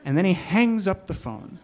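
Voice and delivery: plain reading voice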